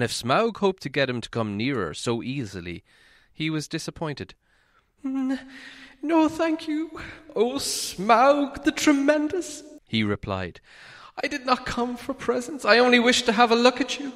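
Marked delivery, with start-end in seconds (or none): 5.0-14.1 much higher, stammering hobbit voice; frightened hobbit flattering the dragon, high and nervous. A narrator's reading. Narrator voices nervous Bilbo.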